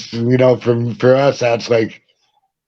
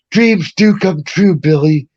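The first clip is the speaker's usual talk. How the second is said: higher, slow, hammed-up rock star croon; 5 semitones higher, slower than usual. Retelling a meeting, imitating the idol's higher slow croon. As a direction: lay it on like a grand rock frontman blessing a fan. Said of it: "in his [name] voice".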